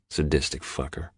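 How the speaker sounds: ordinary delivery, nothing marked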